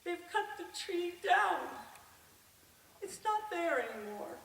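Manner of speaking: understated sorrow, voicing a sister; retold sad news. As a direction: break the bad news in separate, paused phrases. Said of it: "she sadly looked at me and told me".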